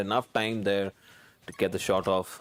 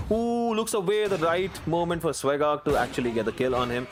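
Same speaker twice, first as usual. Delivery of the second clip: excited; 8 semitones higher than usual. Real, not acted. Caster reacts to a kill.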